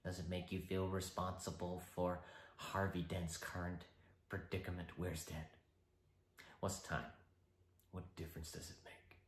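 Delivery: soft, low, teasing Joker; icy polite interrogator. Acted. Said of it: "interrogation scene involving ... the Joker".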